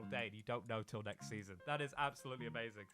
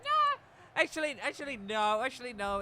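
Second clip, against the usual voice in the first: a hint of a smug high voice on nah; mocking the game; 9 semitones higher, 8 dB louder than usual. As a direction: tease like the game is toying with you. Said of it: "but the game decides".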